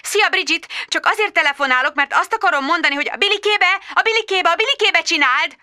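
high hurried mum voice; frazzled mum. Acted. Magda on the phone coaxing her toddler, high and fast. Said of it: "Magda on phone to toddler".

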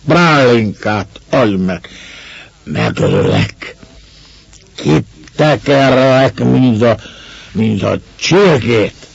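put-on slow, drawn-out drunken hoarse demands; hoarse ugly yelling. A narrator's reading. Drunk Ivan demanding brandy from the master, hoarse yelling. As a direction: drag the words out like a raging drunk. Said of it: "Rekedten, csúnyán ordított".

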